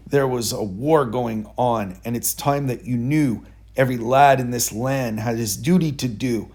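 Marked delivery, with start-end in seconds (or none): none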